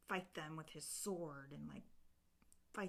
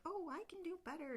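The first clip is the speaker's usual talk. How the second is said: fairy voice; 12 semitones higher, faster than usual. Retold or imitated. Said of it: "another fairy is like".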